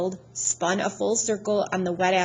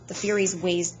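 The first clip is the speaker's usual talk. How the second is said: usual speaking voice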